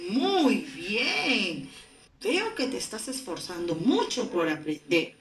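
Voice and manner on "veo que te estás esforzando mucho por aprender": ordinary delivery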